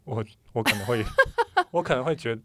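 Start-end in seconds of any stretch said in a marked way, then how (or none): 1.1-1.6 high laugh. Real, not acted. Laughing before answering.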